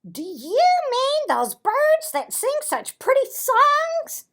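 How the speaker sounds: very high, loud silly voice; squeaky Tacky. Acted. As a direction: squeak it out like a goofy bird. Tacky asks, much higher voice.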